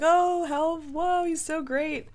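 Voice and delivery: high, drawn-out fan awe; mock gushing. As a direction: imitate a crowd swooning over a star. Imitating the admiring bar crowd.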